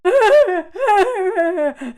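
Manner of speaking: loud mocking burst of laughter. Real, not acted. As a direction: laugh out at them. Laughing at crying men.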